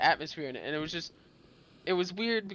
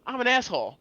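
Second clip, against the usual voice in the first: higher and louder, half-joking protest; mock emphatic protest; 8 semitones higher, 8 dB louder than usual. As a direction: push back loudly, playing it up. Retold own protest, emphatic.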